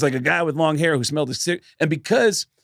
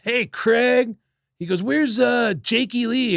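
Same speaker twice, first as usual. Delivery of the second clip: raised pitch, hailing a friend; higher, casual hail; imitated calling out; 8 semitones higher, slower than usual. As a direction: mimic someone calling over to a pal. Dean calls out looking for someone.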